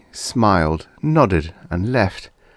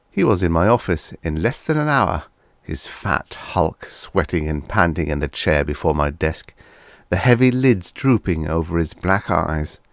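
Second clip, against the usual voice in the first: usual speaking voice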